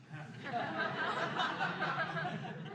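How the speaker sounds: high breathy burst of laughter; giggling fit. Real, not acted. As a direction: crack up at the absurdity. Laughing at own punchline.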